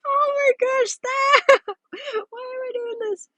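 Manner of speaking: helpless high squeal of real laughter; cracking up, barely getting it out; laughing hard. Real, not acted. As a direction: squeak the words out through giggles. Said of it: "I shouldn't be laughing this hard".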